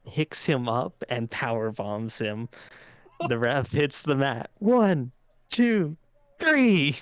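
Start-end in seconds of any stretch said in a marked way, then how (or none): 4.6-7.0 loud rising ref count. Acted. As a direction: slam each count louder and higher.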